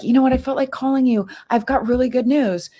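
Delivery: a hint of a giddy friend; lightly voiced excited friend. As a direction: mimic her cheerful announcement. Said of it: "And she's like".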